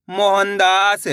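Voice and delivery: Mohandas drawn out, long आ in दास; joined-up demonstration. Shown as a demonstration. Teacher blending the letters for learners, Mohandas drawn out. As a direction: stretch the whole of Mohandas. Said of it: "तो मिलके बन जाएगा".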